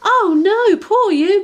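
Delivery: high, loud cry of dismay; girl horrified for the spider. Acted. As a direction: gasp in horror. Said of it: "cried Daisy in horror".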